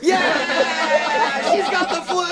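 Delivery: high, whooping celebration; triumphant cheer. Real, not acted. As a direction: cheer in delight at the prank landing. Pranksters celebrate her playing along.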